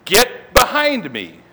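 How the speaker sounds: slow, drawn-out confrontational order; stern command. Retold or imitated. Jesus rebukes Peter before the disciples, stern and slow. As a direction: spell out the rebuke slowly and firmly. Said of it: "He probably says it in a stern voice".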